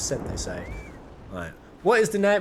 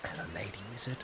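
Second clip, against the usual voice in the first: soft secretive whisper; 8 semitones lower, 13 dB quieter, faster than usual. Acted. Streamer greeting the lady wizard in a whisper.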